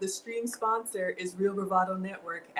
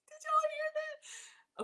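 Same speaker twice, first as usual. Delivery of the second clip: very high, slow, softer squeal; hushed squeal; 7 dB quieter, slower than usual. Real, not acted. Hearing yet another donation, high hushed squeal. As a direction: squeak it in disbelief.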